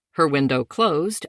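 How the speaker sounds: usual speaking voice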